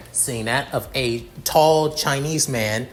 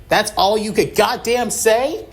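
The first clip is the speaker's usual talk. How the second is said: much higher and louder, "goddamn" thrown hard; angry outburst; 9 semitones higher, 4 dB louder than usual. Acted. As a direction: let the anger burst out. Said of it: "ticked off mark".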